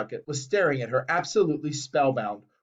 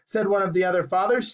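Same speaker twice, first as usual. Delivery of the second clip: ordinary delivery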